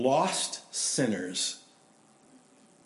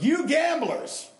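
preacher's shout; 8 semitones higher, 4 dB louder than usual. Retold or imitated. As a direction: thunder it at the gamblers from the pulpit. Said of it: "he tells them".

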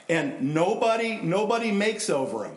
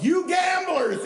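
slow, much higher, loud preacher's cry; preacher's shout; 5 dB louder, slower than usual. Retold or imitated. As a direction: hurl it out again from the pulpit. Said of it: "he said right then".

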